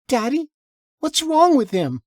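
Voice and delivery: put-on high child voice, scared and rising; a small girl afraid of the stranger; frightened little girl. A narrator's reading. Daughter asking her father about the stranger, scared high child voice. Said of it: "fear in her voice".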